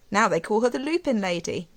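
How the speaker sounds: ordinary delivery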